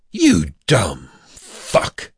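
slow, low, contemptuous curse; angry shout. A narrator's reading. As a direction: drag out the insult with low-pitched anger. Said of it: "he shouted".

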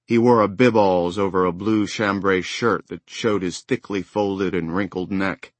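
plain reading voice

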